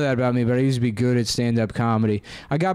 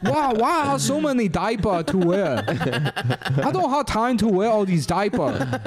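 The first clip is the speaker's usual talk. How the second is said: high-pitched put-on Chinese accent; Chinese auntie accent; 8 semitones higher, slower than usual. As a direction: squeak it like a fussing auntie. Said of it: "It sounds like a Chinese person".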